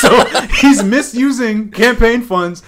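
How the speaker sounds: laughing through the words; can't hold back a laugh; laughing while talking. Real, not acted. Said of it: "I'm sorry if I sound like I'm laughing".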